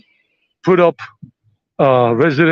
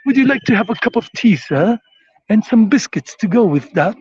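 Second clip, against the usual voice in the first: high, put-on British accent; 6 semitones higher than usual. Shown as a demonstration. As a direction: do a polite British tea offer, pitched up. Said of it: "That's the best I can do".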